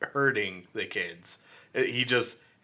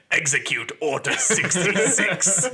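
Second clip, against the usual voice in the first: [name] as Palpatine; 7 semitones higher, 5 dB louder, slower than usual. Retold or imitated. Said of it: "he's like".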